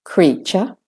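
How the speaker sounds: British-Australian "creature", rising on a schwa. Shown as a demonstration. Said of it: "doing British and Australian first".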